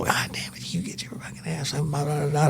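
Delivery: whispered mocking rant of the wife on the phone; mock her angry call under the breath. Husband mimics his angry wife's phone call, whispered mock rant.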